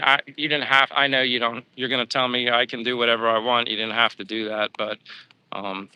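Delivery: ordinary talk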